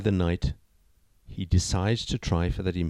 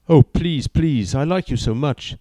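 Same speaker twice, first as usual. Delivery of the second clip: begging; 6 semitones higher, 6 dB louder than usual. A narrator's reading. Boyfriend begging loudly.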